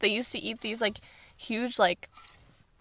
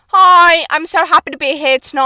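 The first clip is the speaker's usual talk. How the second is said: higher, louder mock British greeting; hammed-up British accent, pitched up; imitating British host; 5 semitones higher, 9 dB louder than usual. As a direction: gush like a British talk show host. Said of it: "her monologue was like".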